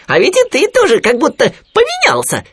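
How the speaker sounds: very high, loud little animal voice; Khryunya. A narrator's reading. Khryunya visiting his friend Winnie, high piping voice. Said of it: "заметил Хрюня".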